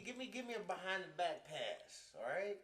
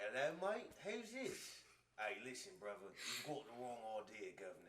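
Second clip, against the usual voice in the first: put-on a lightly done British accent, dropping lower on brother and governor; mock British accent; 6 semitones lower, 4 dB quieter than usual. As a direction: play a half-hearted cheeky British bloke. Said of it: "He's not that British".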